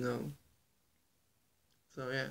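normal talking voice

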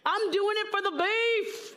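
high, proclaimed self-righteousness; self-righteous. Acted. Hungry diner justifying going without, mock self-righteous. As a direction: announce it loftily, high-pitched. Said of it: "there would have been self-righteousness".

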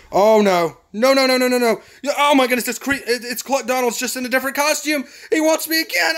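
high, loud alarmed babble; panicked. Real, not acted. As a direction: panic as the enemy closes in. Enemy comes after him again.

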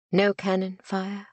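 plain reading voice

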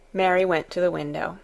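ordinary delivery, nothing marked

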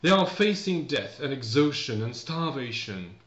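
slow, deliberate English reading; read-aloud for learners. Acted. Teacher reads the story summary to learners, measured read-aloud. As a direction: read it out slowly, carefully spacing each phrase.

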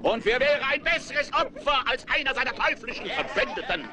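public proclamation. Acted. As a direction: proclaim to the whole square. Town crier addressing citizens.

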